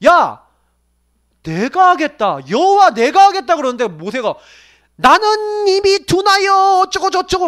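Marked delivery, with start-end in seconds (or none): 0.1-3.6 loud, rising mock-angry command from God. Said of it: "하나님이 열받으신 거예요". 4.9-7.5 high, slow, drawn-out whine; mock Moses' reluctant excuse; whiny Moses. Moses whining excuses to God.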